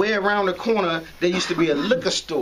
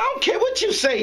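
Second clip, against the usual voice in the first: much higher defiant outburst; 13 semitones higher than usual. Retold or imitated. Young man defies the arresting cop, high defiant outburst. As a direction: throw it in his face, high.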